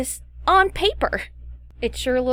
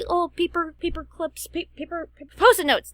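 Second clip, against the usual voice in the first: imitating industry people; 5 semitones higher than usual. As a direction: mimic people suggesting their favorite tool. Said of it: "A lot of people say, like, in the industry".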